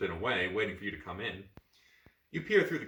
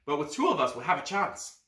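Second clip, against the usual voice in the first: urgent battle plan; 8 semitones higher, 7 dB louder than usual. Acted. Boy planning ambush.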